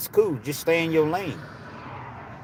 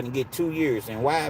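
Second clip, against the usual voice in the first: usual speaking voice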